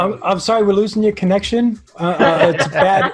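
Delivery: mock apology, giggling; laughing fake excuse. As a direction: laugh while pretending the line is breaking up. Jokingly fakes a bad connection.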